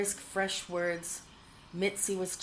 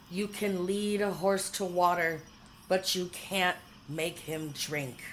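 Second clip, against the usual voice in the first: ordinary delivery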